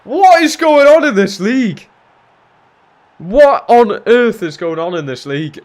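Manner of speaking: high, loud disbelieving yell; outraged shout. Real, not acted. Player just conceded a goal, outraged shouting. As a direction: explode in disbelief at the game.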